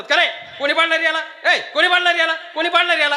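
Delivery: high, loud repeated demand; indignant parent. Acted. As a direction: play an outraged parent confronting children. Parent demanding who pushed their child.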